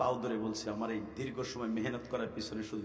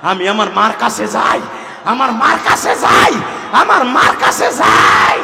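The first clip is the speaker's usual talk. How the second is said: full-force repeated yell, each louder; desperate shouting; 9 semitones higher, 24 dB louder than usual. Acted. Youth begs Musa to let him reach his mother, frantic shout. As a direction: scream the plea with mounting urgency.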